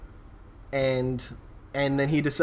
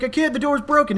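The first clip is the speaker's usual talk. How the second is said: higher, strained protest; friend pleading; 10 semitones higher, 3 dB louder than usual. Retold or imitated. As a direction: plead that you're stuck.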